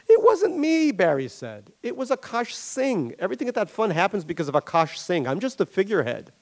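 high, defensive denial from Barry. Acted. Barry denies firing Jeff.

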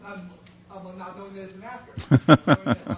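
loud and slow, laughing through it. Real, not acted. Audience member joking he'd punch the actor, laughing loudly.

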